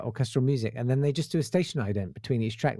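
ordinary delivery

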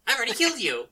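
high, loud mock defiance; hammed-up brave boast; defiant taunt. Voicing defiant character to ghost.

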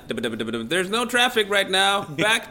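loud, slow, drawn-out traffic report; helicopter reporter. Pretend. Guest handing back to Jan in the studio, helicopter reporter voice. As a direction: shout over the rotor like a radio reporter. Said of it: "talk in a helicopter when doing the weather".

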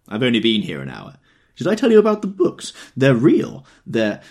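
tipsy owl-eyed man. Acted. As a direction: babble on like a delighted drunk. Drunk man rambling.

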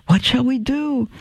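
high-pitched, mildly worried question; frightened child. A narrator's reading. Scared Jill asking Audrey what to do, high and worried. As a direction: lift it high as an anxious little girl.

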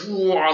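high whooshing roar of a sound; imitated stinger lunge. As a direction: mimic a stinger leaping out at you. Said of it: "its stinger's like".

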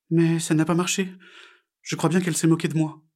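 high, crestfallen little frog voice; sad reply. A narrator's reading. Frog realises she was mocked, high sad voice. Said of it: "répondit Pigouille tristement".

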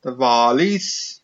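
slow Welsh accent, rising at the end. Shown as a demonstration. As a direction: show off your Welsh, stretching the words. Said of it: "I can do Welsh perfect".

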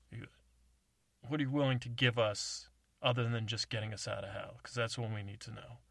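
ordinary talk, nothing marked

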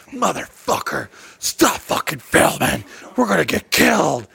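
mock-angry scolding in a put-on voice; imitating mad girlfriend. Girlfriend orders him to stop filming, mock furious. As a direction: play her fuming panic. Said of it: "when she'd get mad".

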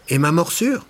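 high, loud protesting cry; indignant outcry. A narrator's reading. Élie protesting to the doctor, high indignant cry. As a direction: object in disbelief. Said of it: "se récria Élie".

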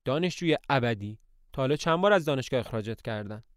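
ordinary delivery, nothing marked

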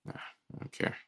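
under the breath, nearly voiceless; muttered dismissal. Real, not acted. Brushing off his friend's praise, muttered under breath. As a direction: shrug it off under your breath.

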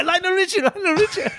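very high, delighted outburst; excited exclamation. Retold or imitated. Reliving guessing the singer for his student, excited. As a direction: squeal the name, breaking into laughter.

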